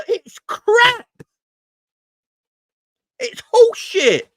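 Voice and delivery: high, strained cry of disgust; exasperated outburst. Real, not acted. Fan ranting about English pundits, exasperated. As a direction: let real frustration push the voice way up.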